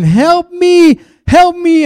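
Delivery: high-pitched, loud mock cry for help; hammed-up, very high desperate yelling. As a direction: cry out as a man acting trapped with a lion. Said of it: "and he's saying".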